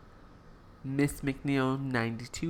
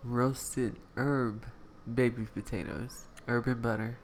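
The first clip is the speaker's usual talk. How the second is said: soft menu voice; 4 semitones lower, 8 dB quieter than usual. Acted. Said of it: "I was trying to set the tone here".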